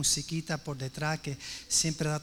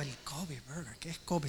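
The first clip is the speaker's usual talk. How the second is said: puzzled; 4 semitones lower, 12 dB quieter than usual. Retold or imitated. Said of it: "Y yo digo".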